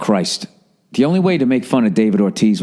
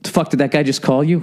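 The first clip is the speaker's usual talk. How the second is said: imitating Poppy; 7 semitones higher, faster than usual. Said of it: "he goes".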